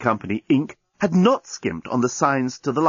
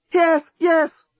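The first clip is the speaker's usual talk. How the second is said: much higher, louder ecstatic cry; cried out; 4 dB louder than usual. Acted. Lucy on top of the journalist, a high ecstatic cry. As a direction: cry out in rapture. Lovers in passion.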